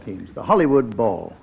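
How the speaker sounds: normal talking voice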